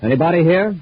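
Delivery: loud, high call into an empty shop. Acted. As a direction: call out to whoever might be in back.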